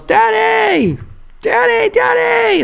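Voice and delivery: high-pitched drawn-out imitation of a calling kid; child's yell. Young son yelling to his dad from the tent, high mock yell. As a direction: call out like a little boy wanting Dad. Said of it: "and he yells".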